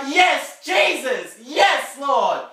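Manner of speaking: high, loud cries of devotion; fervent praise. Acted. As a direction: shout it as a fired-up believer. Acting out fired-up believer.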